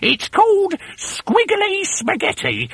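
lie it sweetly; innocent hag wife. A narrator's reading. Wife fibs about the worms, high fake-innocent hag. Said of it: "Missus Twit said".

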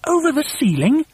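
high, incredulous question; high voice of baffled followers; astonished cry. A narrator's reading. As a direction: question it in high-pitched disbelief. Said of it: "they cried".

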